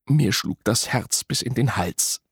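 ordinary delivery, nothing marked

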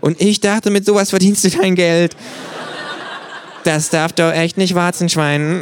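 hohe, verspielte Känguru-Stimme. A narrator's reading. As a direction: frech und hoch als Känguru. Känguru neckt. (freche, hohe Känguru-Stimme)

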